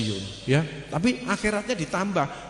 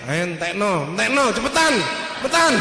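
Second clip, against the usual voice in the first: hustle them along, louder on each repeat; acted hurried urging; 2 semitones higher, 6 dB louder than usual. Urging someone to quickly finish the gulai, mock insistent.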